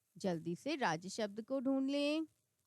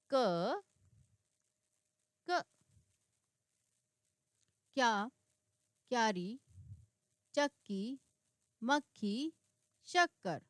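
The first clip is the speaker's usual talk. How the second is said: put-on careful reading for children, word by word; slow word-list reading. Shown as a demonstration. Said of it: "बच्चों के साथ और घर के लोगों के साथ इसको खेल सकते हैं".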